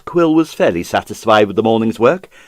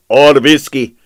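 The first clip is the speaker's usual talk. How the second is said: a grim, louder afterthought; dry and grim, a touch louder; 3 semitones higher, 6 dB louder than usual. Acted. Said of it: "said Serge grimly".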